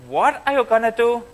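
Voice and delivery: retold astonishment, pitch jumping up high; high, stunned question, lightly played; mock incredulous. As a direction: act out the men's disbelief at her plan. Men astonished by plan to invade.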